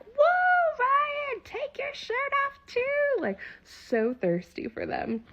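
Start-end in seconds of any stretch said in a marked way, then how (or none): 0.0-3.2 put-on very high, swooning old lady voice; high giddy granny voice; old ladies swooning. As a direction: squeal it like a smitten old lady. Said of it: "and they're like".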